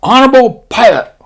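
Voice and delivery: high, loud mock-Japanese admiral. Admiral greeting his assembled pilots, mock-Japanese bark. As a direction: bark it in a put-on Japanese accent. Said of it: "the admiral walks up on stage".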